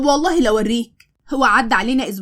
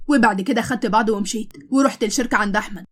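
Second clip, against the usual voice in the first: ordinary delivery, nothing marked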